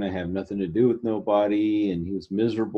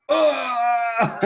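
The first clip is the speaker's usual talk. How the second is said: startled scream; 3 dB louder than usual. Retold or imitated. Startled by noise in woods.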